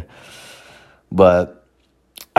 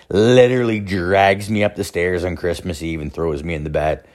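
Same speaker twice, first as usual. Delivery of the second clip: normal talking voice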